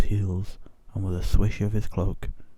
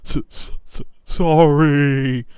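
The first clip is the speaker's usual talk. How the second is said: stuttering, slow, higher sob; sobbing apology; 6 semitones higher, 10 dB louder, slower than usual. Acted. Giant apologising to the witch, sobbing and stammering. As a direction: choke the word out through tears. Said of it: "sobbed Hagrid".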